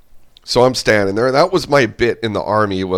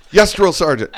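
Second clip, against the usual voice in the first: laughing recruit reply; 9 semitones higher than usual. Retold or imitated. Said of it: "And I'd run over there".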